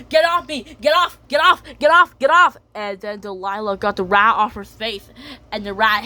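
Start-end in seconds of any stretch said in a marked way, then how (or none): 0.1-2.6 high, loud panicked yells; panicked shouting. Acted. Cat shouting high and loud at the rat on her face.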